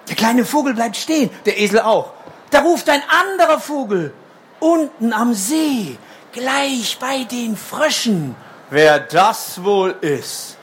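slow, suspenseful read-aloud; reading to a toddler. A narrator's reading. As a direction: build gentle suspense for kids.

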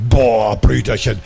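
low, drawn-out awestruck "Boah"; thrilled awe. Acted. Sister marvels to her brother at the big factory, thrilled awe. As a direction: let the wow swell, then address little brother.

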